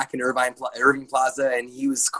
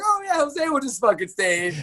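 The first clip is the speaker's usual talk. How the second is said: high, put-on mimicking voice; mocking imitation; 12 semitones higher than usual. Employee imitates bosses discussing his pay, high mocking voice. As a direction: mimic bosses taking you for granted.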